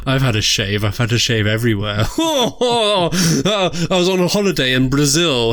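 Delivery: cheeky, giggly camp diva; campy drag queen. Acted. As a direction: tell it like a naughty drag diva. Host persona bawdy tale.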